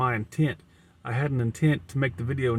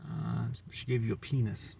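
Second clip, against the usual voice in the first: ordinary talk, nothing marked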